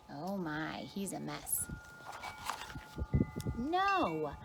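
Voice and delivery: ordinary delivery, nothing marked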